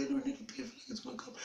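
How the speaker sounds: put-on high-pitched urgent kid; squeaky little-kid urgency; desperate child. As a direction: plead as a child about to wet himself.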